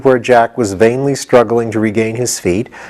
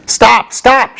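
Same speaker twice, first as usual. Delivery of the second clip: shouted; 12 semitones higher than usual. A narrator's reading. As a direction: yelp at him to stop. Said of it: "shouted the horse".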